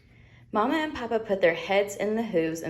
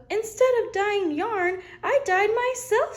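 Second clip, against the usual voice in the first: much higher, louder gleeful lamb; high excited Wilbur; thrilled little lamb; 5 dB louder than usual. Acted. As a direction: squeal it with delight.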